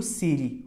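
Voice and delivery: CITY said like "siri"; American flapped T. Shown as a demonstration. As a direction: tap the T in CITY. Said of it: "o inglês americano fala muito assim". ("city" com R brando no lugar do T)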